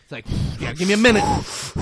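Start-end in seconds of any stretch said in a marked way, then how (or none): 0.6-1.2 nervous cop voice. Retold or imitated. Retold cop bracing to be shot.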